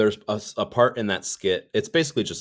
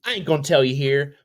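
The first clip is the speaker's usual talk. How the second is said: said again higher and louder; higher playful repeat; 9 semitones higher, 6 dB louder than usual. Shown as a demonstration. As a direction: bump it up in a teasing voice. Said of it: "repeat, second higher".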